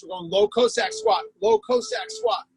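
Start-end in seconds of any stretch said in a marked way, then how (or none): none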